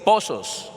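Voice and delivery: pozos with the first O stressed; accent on O. Shown as a demonstration. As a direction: say pozos as PO-sos, falling. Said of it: "con acento en la O".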